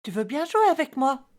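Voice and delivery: enfant qui demande. Acted. Enfant demande à jouer au parent. (voix légèrement enfantine, plus aiguë, qui demande)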